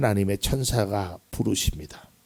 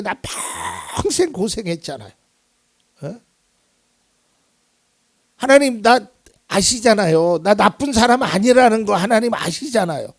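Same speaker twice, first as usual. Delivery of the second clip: pleading cry; 10 semitones higher, 3 dB louder than usual. Pretend. Preacher voices a pleading believer.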